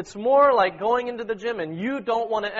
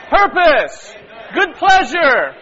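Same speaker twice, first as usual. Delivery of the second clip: high, very loud one-phrase proclamations; emphatic shout; 9 semitones higher, 11 dB louder, much slower than usual. Real, not acted. Leader driving the verse's key words home, emphatic shout. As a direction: shout each key phrase to stir the room. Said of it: "And emphasize".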